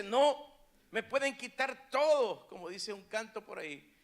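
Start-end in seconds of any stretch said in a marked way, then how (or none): 0.0-2.5 loud, high preacher's protest; firm refusal in a raised voice; defiant declaration. Acted. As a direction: proclaim it as an unshakable believer. Voices a defiant believer while preaching.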